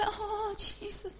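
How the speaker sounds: high, soft, drawn-out whimper; imitating a weak, wavering prayer; mock helpless plea. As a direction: plead feebly, as if giving up. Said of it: "And we go".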